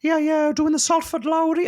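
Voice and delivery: throat squeezed shut with nerves, voice jumped way up; nerves-strangled voice. Retold or imitated. Nervous fan talks to his hero, voice choked high. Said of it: "not only has my voice gone high".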